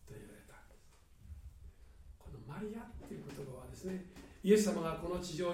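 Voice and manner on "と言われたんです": unvoiced hushed whisper. Real, not acted. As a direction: breathe the words out without voice. Whispered narration. (ささやき声で)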